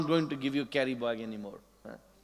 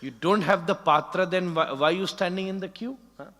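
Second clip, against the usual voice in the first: scolding, growing louder at the end; angry; 3 semitones higher, 5 dB louder than usual. Retold or imitated. Nanda Baba scolding a devotee without a pot, rising anger. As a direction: scold the devotee, building to a shout. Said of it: "Nanda Baba get angry".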